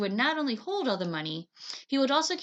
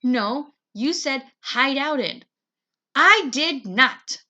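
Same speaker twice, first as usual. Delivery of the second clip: loud, slow stubborn shout; quarrel shout; 4 semitones higher, 9 dB louder, much slower than usual. Acted. Siblings shout at each other, loud and slow. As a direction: dig in and yell it.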